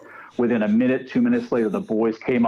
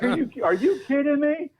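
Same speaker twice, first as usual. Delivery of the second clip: mock outraged friend. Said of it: "He was so pissed".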